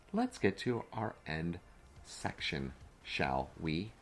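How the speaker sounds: measured, over-careful enunciation. Real, not acted. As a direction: say it slowly and deliberately after a slip of the tongue. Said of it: "I can't even speak it".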